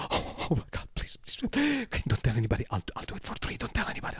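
whispered, secretive pleading; imitating Nacho begging. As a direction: plead softly, keep it secret.

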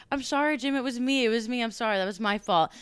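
high, loud rushed sorry; mock frantic apology. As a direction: blurt out apologies to the boss. Apologizing to angry boss.

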